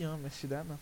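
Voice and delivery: ordinary talk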